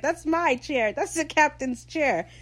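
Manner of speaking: high-pitched, hammed-up captain's tantrum; mock scream. Recapping the captain claiming his chair, mock screaming. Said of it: "he starts screaming".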